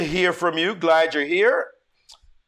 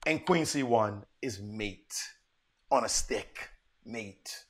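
hushed dramatic reveal; 6 semitones lower, 9 dB quieter, slower than usual. Real, not acted.

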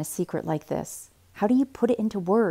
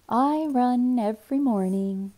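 sing-song and slow, pitched higher; slow, higher sing-song boast; 5 semitones higher than usual. A narrator's reading. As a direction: tease him in a lilting sing-song. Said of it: "I say in a sing-song voice".

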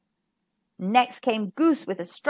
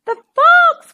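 very high, loud alarmed owl cry; 11 dB louder than usual. A narrator's reading. Owl spots the fox in the coop, very high loud cry. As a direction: scream the alarm. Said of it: "cried Owl".

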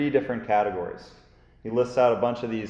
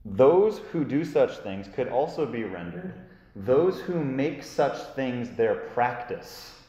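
ordinary delivery

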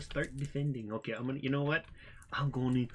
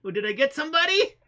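high, loud excited squeal; gleeful excitement; 7 dB louder than usual. Real, not acted. Player hoping his fireball hit somebody, gleeful squeal. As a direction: squeal it, breaking into a laugh.

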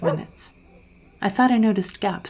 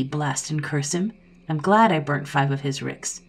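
ordinary delivery, nothing marked

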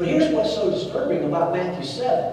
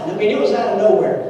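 ordinary talk, nothing marked

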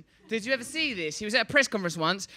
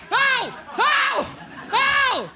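mock pained yelps; 5 dB louder, much slower than usual. Imagined victim being hurt.

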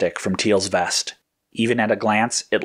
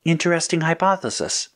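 higher, slowing computer voice; uncharacteristic computer; 6 semitones higher than usual. A narrator's reading. Hijacked computer taunting Red, uncharacteristic. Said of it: "Chroma computer said uncharacteristically".

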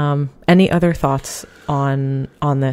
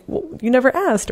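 lifted much higher, tart comeback; pointed retort; 10 semitones higher than usual. Retold or imitated. Friend answering the complainer, a tart high retort. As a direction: toss it back with a pitched-up jab.

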